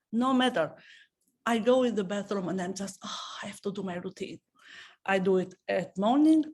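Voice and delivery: ordinary delivery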